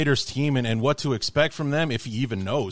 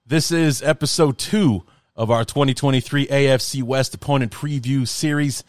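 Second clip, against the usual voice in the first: loud, raised announcer intro; show intro; 4 semitones higher, 5 dB louder than usual. Acted. Host opening his podcast episode for listeners, announcer voice. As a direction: kick off the episode like a host on air.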